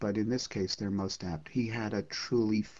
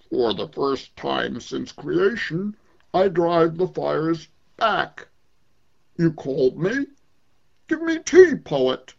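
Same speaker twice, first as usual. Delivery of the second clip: slow, grand, booming demand; the sun speaking; 6 semitones higher, 7 dB louder, slower than usual. Acted. The sun demanding tea from the poet, grand and loud. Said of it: "he spoke deep bass".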